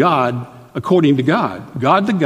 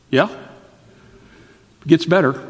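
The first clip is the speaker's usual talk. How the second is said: teasing tease; 3 semitones higher, much slower than usual. Acted. Teasing build to good news.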